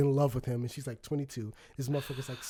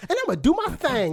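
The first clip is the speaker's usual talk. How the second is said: higher, punchy mock movie delivery; a put-on tough-guy swagger, pitched up; mock cool-guy line; 9 semitones higher, 4 dB louder than usual. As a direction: ham up the mobster-cool line. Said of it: "he didn't just go".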